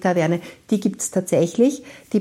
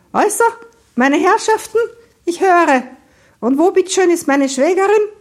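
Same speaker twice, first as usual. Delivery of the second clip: high, loud, imperious voice; commanding, high-pitched schoolmistress; stern schoolteacher; 11 semitones higher, 6 dB louder than usual. Acted. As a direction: address them like a disobedient class. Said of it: "als hätte sie eine ungehorsame Schulklasse".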